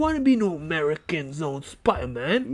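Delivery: put-on Cockney accent; Tom Holland, Cockney. Co-host posing as British actor quitting Spider-Man, mock Cockney. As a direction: do a hammed-up Cockney. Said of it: "That was a good British Cockney accent".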